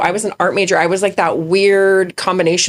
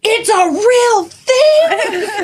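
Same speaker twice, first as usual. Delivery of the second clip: put-on little girl voice; much slower than usual. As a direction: shout it like an earnest little girl. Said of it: "she goes".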